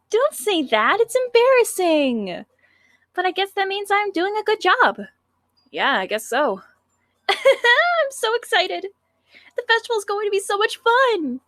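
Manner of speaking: put-on high, whiny cute girl. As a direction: pout playfully like a sweet schoolgirl. Sayori's voice.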